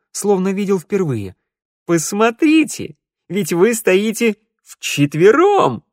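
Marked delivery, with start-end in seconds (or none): 1.8-5.8 high, slow, delighted exclamation; light up with a discovery; excited minstrel. Acted.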